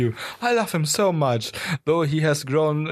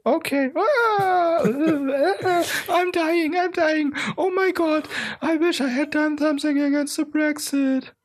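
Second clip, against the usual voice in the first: much higher, hammed-up cries of dying; 11 semitones higher than usual. The prime minister dies after the monkey attack, hammed-up high wail.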